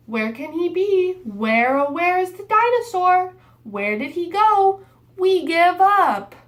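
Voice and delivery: high and loud searching call. Acted. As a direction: call out as if hunting for him. Children calling out, searching.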